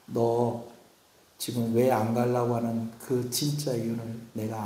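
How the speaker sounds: soft, gentle reassurance, fading to a breath; a lightly voiced God, tender and soft. God tells Moses he knows his hurt, soft and tender.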